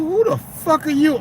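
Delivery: high, slow incredulous challenge; retold challenge. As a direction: stretch it out high, daring him. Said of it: "I said".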